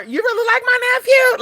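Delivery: very high mock disbelief; incredulous auntie. Auntie questioning her nephew's admirer, high-pitched incredulity. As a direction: imitate an auntie squeaking in disbelief.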